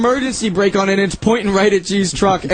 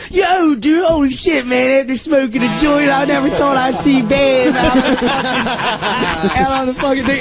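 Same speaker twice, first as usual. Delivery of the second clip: stoned fisherman; 5 semitones higher than usual. Retold or imitated. Fishermen marveling at seeing Ben on the river, stoned. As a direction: play the baked fisherman, wowed.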